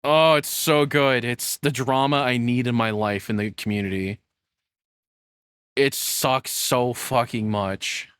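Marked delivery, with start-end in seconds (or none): none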